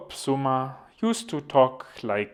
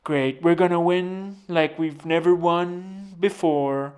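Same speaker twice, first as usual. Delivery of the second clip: slow, higher Trump voice; Trump impression; 5 semitones higher, 3 dB louder, slower than usual. Mocking Trump's repetitive speeches, slow and drawn. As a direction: stretch every word like Trump at a rally. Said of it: "So he'll say".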